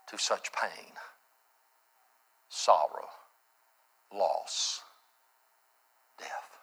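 low, soft words with long pauses; hushed solemnity. Real, not acted. Dwelling gravely on Christ's agonies.

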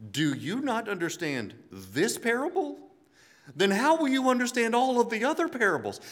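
disbelieving, rising to an almost shouted question; exasperated Jesus. Retold or imitated.

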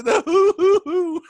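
high, slow laughing hoo hoo; hoo hoo chant. Retold or imitated. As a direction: mimic the Arsenio crowd whoop. Imitating Arsenio crowd chant.

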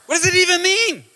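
high-pitched, incredulous mock outburst; playing utterly baffled, voice shooting up; baffled exasperation. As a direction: squeal it in hammed-up bewilderment. Acted baffled reply to quoted scripture.

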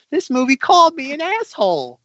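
high-pitched mock outrage; Stephen King. Author watching the adaptation of his book, high mock indignation. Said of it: "Stephen King watching The Shining in nineteen eighty being like".

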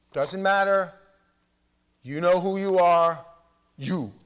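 loud and pointed, driving it home; loud preaching. Acted. Preacher calling out the congregation, loud and pointed. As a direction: call them out at full volume.

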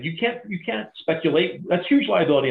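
high fussy lawyer voice; mocking lawyers. Teller mimics the nervous bank lawyers, high and fussy. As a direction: squeak out their worried objection.

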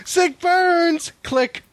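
high mocking prank call voice; prank caller voice. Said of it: "That was rude".

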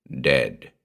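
low, offhand pronouncement; indifferent. A narrator's reading. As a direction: drop the word with no concern. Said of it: "he said indifferently".

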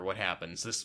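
usual speaking voice

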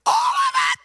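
high, loud mock screech of an angry wife; hammed-up shocked wife voice; shrill wife impression. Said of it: "That was kind of what her voice said".

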